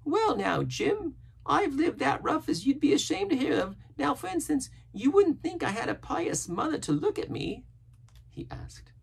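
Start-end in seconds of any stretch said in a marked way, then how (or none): 0.0-7.6 very high rambling sailor voice; chatty castaway, confiding. A narrator's reading. Castaway confessing rough living to Jim, earnest.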